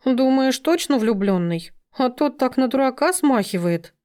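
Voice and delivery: high-pitched animal character voice; sighing doubt. A narrator's reading. Frosya asking Sima about Sergei, sighing doubtfully. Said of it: "вздохнула Фрося".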